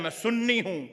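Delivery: ordinary talk, nothing marked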